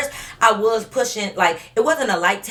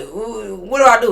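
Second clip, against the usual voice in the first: Mister Krabs confused; 3 semitones higher, 6 dB louder than usual. Retold or imitated. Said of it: "there giving Mister Krabs".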